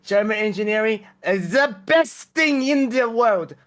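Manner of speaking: emphatic mock German accent. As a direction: boast in a put-on German accent. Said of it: "Sorry, I had a German accent".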